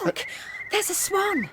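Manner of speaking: high, delighted exclamation at a sighting; excited discovery. Acted. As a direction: call it out, thrilled to spot it. Girl spots a swan.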